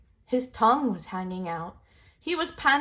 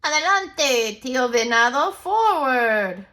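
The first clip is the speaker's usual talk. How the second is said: high, loud call; shouting; 5 semitones higher, 8 dB louder, slower than usual. Acted. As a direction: shout from up ahead. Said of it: "a toad voice shout out".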